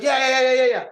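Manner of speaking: rapid high brushing-off yeahs; impatient yeah yeah. Retold or imitated. [name] brushing off the Birds of Fire question, impatient. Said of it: "He's like".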